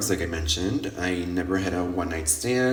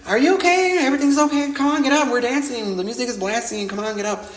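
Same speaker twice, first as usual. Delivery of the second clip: mock concerned squeal; 13 semitones higher, faster than usual. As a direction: cheer her up off the floor, giddy. Friends urging her back up.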